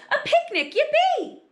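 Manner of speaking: high, loud squeal of glee; excited shout. A narrator's reading. Children cheering the picnic news, gleeful shout. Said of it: "everyone shouted".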